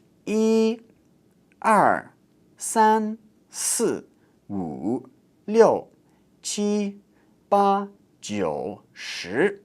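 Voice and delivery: "一" to "十" singly, each tone exaggerated; repeat-after-me drill. Shown as a demonstration. Teacher counting for learners to repeat, careful tone drill. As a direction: count "一" to "十" with pauses and distinct tones. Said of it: "So, repeat after me".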